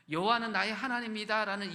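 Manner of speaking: loud, emphatic proclamation of 여호와는; proclaimed. Shown as a demonstration. Preacher proclaims Elijah's name meaning to congregation, loud. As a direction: declare 여호와는 boldly and loudly. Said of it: "name meaning proclaimed".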